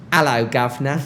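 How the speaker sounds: loud, hammed-up Cockney greeting; mock British accent. Briton mimics Americans greeting him, hammed-up British accent. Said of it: "every time someone said to me in America".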